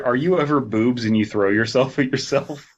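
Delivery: ordinary talk, nothing marked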